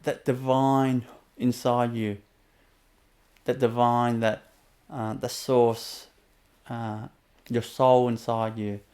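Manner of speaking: unhurried inner-journey guidance; guided meditation. Acted. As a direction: guide the listener gently inward.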